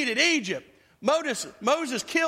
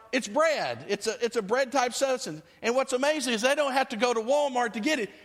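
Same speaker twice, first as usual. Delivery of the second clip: normal talking voice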